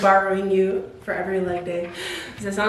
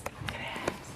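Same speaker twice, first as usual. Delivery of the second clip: whispered brag; 17 dB quieter, slower than usual. Shown as a demonstration. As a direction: whisper it proudly.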